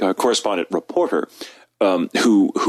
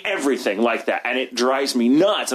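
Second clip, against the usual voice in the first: ordinary talk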